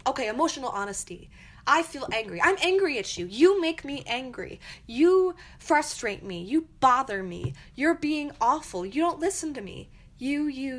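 building accusatory yell at a partner; mock attacking rant. Shown as a demonstration. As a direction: act out an angry blaming attack. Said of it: "That's an attack".

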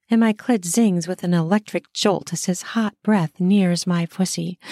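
ordinary delivery, nothing marked

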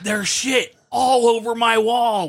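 high-pitched, slow panicked outburst; freaking out. Retold or imitated. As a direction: shriek it in slow horror. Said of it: "Like, totally freaking out".

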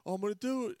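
high-pitched mock threat; hammed-up high, strained plea; imitated desperate man. Acting out suicidal man in skit.

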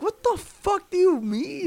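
mock outrage, squeaking high; incredulous. Real, not acted. Ranting about pyramid scheme pitches.

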